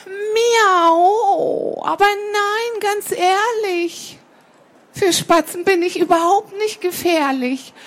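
high, protesting cat voice with long meows; wheedling cat. Acted. Cat assures sparrow it is harmless, high wheedling protest. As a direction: insist on innocence, stretching key words.

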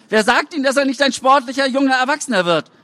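high, pleading voice of a parent; anxious parent pushing back at officials; worried parent. A narrator's reading. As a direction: plead for the son, voice lifted high.

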